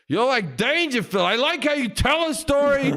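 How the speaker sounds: high, exclaiming movie-star impression; hammed-up, excitable old star; Al Pacino. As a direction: gush compliments high and emphatic. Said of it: "chatted at that party to Al Pacino".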